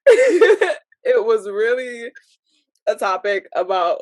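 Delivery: overwhelmed laugh-cry while telling the story; laughing through tears. Real, not acted. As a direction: let the laugh tip into tears. Laughing and crying about topic.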